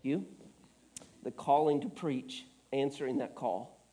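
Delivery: low, soft, choked-up voice; tearful. Real, not acted. Preacher emotional about his calling, quiet and tearful. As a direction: speak softly through tears, humbled. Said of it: "I'm gonna have to blow my nose".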